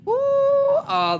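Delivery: high squeaky ooh; excited squeal. Real, not acted. Spotting loot, high squeaky ooh. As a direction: squeal at the find.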